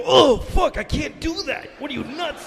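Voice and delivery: high, straining yelp of the plane; protesting airplane. Acted. Plane objecting to the sharp turn, strained high protest. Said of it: "feel the plane go".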